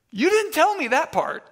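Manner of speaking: high-pitched retold protest; indignant disbelief. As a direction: act out being blindsided by a friend. Retold shock at friend's hidden detail.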